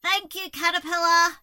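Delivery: loud, high gleeful shout; grateful call. A narrator's reading. Toad leaping high in thanks, gleeful call. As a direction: call it out joyfully. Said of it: "called out".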